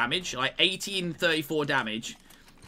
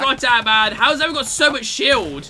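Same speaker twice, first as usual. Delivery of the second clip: louder, exasperated yell at the game; frustrated outburst; 8 semitones higher, 8 dB louder than usual. Real, not acted. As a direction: blurt it out as the fight is lost. Teammate one-shot, streamer frustrated.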